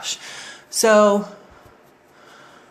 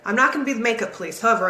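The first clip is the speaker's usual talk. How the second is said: normal talking voice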